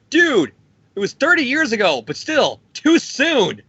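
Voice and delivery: high-pitched comic protest; mock offense. [name] reacts to Tony's jibe, high-pitched mock offense. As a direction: cry out the first and last words, wounded.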